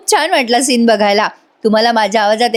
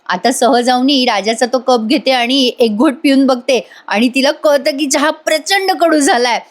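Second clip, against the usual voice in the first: ordinary talk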